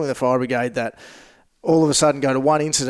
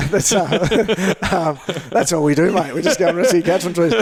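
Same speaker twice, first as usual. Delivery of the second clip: chuckling through the words; light amused laughter; laughing while joking; 4 semitones higher, 3 dB louder than usual. Real, not acted. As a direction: laugh the joke out. Joking about rescuing cats.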